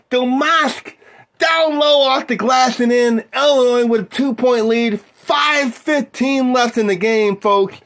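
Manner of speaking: excited play-by-play caller; sports announcer. Acted. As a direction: call the game like a TV announcer. Plays basketball play-by-play.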